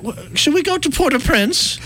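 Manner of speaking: high, put-on fancy voice; mock-refined high voice; fancy vacation planner. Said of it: "I get very fancy".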